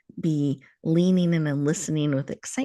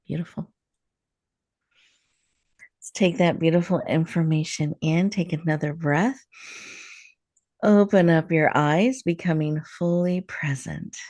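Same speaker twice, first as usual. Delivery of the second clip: guided meditation. Acted. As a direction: ease the listener into stillness, gently. Leads a heart meditation.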